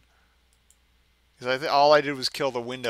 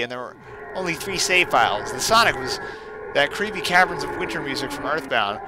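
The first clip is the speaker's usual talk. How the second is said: reading a creepypasta out loud, a bit louder, with some drama; creepy story narration; 4 semitones higher, 4 dB louder than usual. A narrator's reading. Streamer reads a horror story to viewers, dramatic narration. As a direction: spin the spooky tale for the stream.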